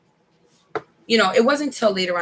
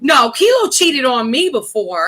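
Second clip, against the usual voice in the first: loud protest; 5 semitones higher, 4 dB louder than usual. Real, not acted. Protesting partner's question.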